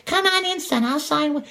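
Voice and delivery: very high, thin mock grandma; old lady voice. Mocking old neighbors letting salesmen in, high granny voice. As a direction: play a gullible old granny. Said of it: "old people that'll get duped".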